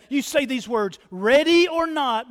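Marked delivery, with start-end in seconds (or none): 1.1-2.3 raised, higher hide-and-seek call. Acted. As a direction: call it out to hidden players. Hide-and-seek call being quoted.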